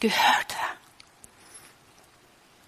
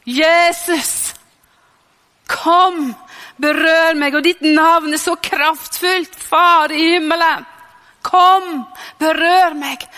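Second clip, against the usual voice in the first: crying out in prayer; 5 semitones higher, 5 dB louder, slower than usual. Retold or imitated. Preacher re-enacting a prayer shouted alone in the car, crying out. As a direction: call on God with full voice, stretching the words. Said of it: "bare rope fra mitt innerste".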